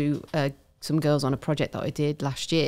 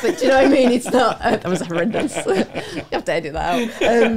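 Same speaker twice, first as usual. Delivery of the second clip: laughing; 6 semitones higher, 5 dB louder than usual. Real, not acted. Laughing at her own accent.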